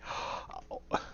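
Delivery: sharp gasp at icy air; cold gasp. Shown as a demonstration. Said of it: "you try to breathe".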